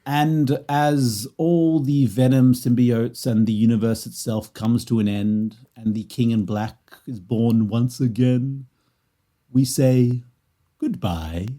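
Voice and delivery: slow, measured epic narration; mock-solemn sign-off. Podcaster closes the show like an epic, mock-solemn.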